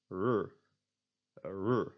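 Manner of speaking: "r" untrilled, close to an English R. Shown as a demonstration. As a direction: say "r" smoothly, without any trill. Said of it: "what I call the English R".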